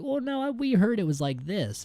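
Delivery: high-pitched objection, falling at the end; mock contradicting tourist. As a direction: chime in high to correct the guide. Retelling tourists contradicting the guide.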